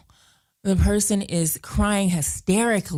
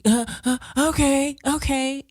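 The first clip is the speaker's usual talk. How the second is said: mock tearful okay; 8 semitones higher than usual. Crying caller's reply retold.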